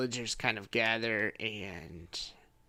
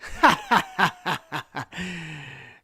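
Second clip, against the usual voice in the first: loud, higher uneasy creature laugh; forced laugh; 5 semitones higher, 8 dB louder than usual. Acted. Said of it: "he says".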